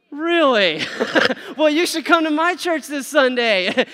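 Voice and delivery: high, loud hammed-up really with a laugh; overdone surprise. Shown as a demonstration. Preacher acting out an overdone invite to a coworker. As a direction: overplay the shock on purpose. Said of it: "don't overdo that".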